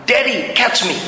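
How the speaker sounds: high-pitched mock yell of a little boy; excited child calling to dad, half-shouted; retold child's yell. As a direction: call out high, like a kid about to jump. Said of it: "dia teriak".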